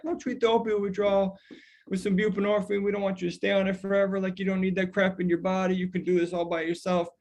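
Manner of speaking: mock a condescending clinician lecturing a patient; dismissive provider. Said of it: "be like".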